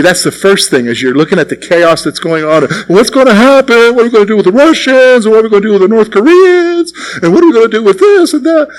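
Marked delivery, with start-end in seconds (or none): 2.9-8.7 fast, higher mock fretting; worked-up worrier. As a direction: pile up questions in acted alarm. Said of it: "I think some of you".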